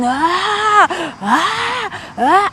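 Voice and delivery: high, hammed-up wailing "ah"; mock crying shouts. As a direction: imitate a child wailing. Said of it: "I'll be shouting".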